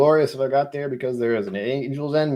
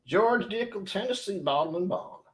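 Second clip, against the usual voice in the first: high-pitched put-on Southern accent; 9 semitones higher, 4 dB quieter than usual. Streamer naming a Tennessee whiskey in a hammed-up Southern accent. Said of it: "I need to stop with the accents".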